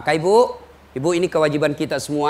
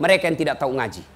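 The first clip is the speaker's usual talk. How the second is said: ordinary delivery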